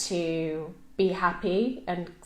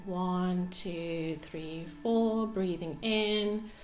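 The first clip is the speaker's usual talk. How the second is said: guided meditation; 6 dB quieter than usual. Real, not acted. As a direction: guide them gently through the breathing, softly and unhurried. Leads a breathing meditation.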